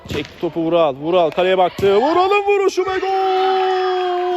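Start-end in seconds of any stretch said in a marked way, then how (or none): 2.0-4.3 screaming goal call. Real, not acted. Goal call in live commentary.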